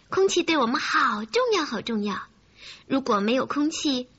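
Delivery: usual speaking voice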